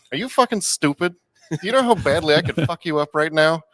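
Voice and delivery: high, loud retold threat; acted-out indignant shout; mock angry outburst. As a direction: imitate an incredulous bouncer raising his voice. Bouncer berating kid, retold.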